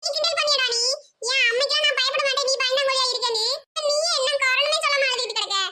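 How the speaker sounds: ordinary delivery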